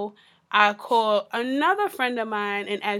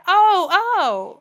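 a hint of startled oh, higher and louder; mock surprise; 7 semitones higher, 6 dB louder than usual. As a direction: lightly act caught off guard. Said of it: "I said".

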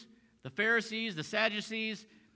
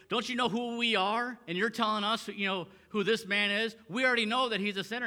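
raised, higher-pitched indignant rebuke; mad, frustrated; 5 semitones higher, 4 dB louder than usual. Retold or imitated. Pharisees scolding the man for telling them, indignant and frustrated. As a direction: put him in his place, affronted. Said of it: "They're frustrated".

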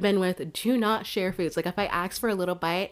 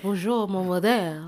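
put-on French accent. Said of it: "I can work on my French accent".

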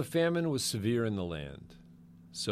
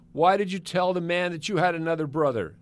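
raised, high voice, accusing; upset reproach; 9 semitones higher, 7 dB louder, faster than usual. A narrator's reading. Father demanding why sons told the man, reproachful. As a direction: fling the question at them in exasperation.

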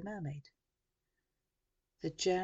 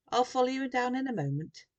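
raised, high grandmother's call; shouting; 7 semitones higher, 7 dB louder than usual. A narrator's reading. Grandmother to Sam as he leaves, shouting. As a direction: call it out loudly, pitched up. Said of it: "shouted his grandmother".

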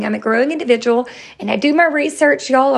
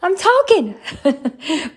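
shrieking denial; 12 semitones higher, slower than usual. Retold or imitated. Wife shrieks back at her husband, much higher, laughing at the end. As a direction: insist you're not screaming while clearly screaming. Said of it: "And I'm like".

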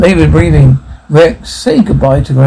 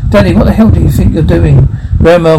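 usual speaking voice

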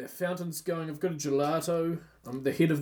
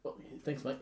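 whispered; 12 dB quieter than usual. Pretend. Quiet aside.

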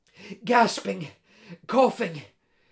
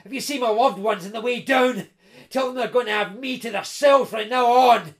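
loud, vicious taunt rising to a shout; snarled threat to a losing rival; snarling; 3 semitones higher, 7 dB louder than usual. A narrator's reading. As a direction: gloat fiercely, building in force. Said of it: "the intruder was snarling".

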